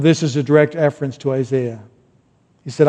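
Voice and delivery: ordinary talk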